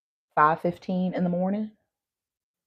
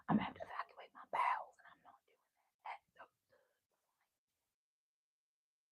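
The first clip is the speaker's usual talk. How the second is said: quick whispered confession; whispered aside; 2 semitones lower, 19 dB quieter, much faster than usual. Real, not acted. Telling viewers why she skips eating, embarrassed whisper. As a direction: whisper it like a secret to the camera.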